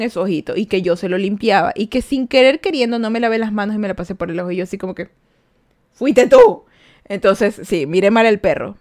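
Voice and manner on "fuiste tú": higher and louder accusing cry; mock accusation. The owner accusing her dog, a mock accusing shout. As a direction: point the finger at the dog.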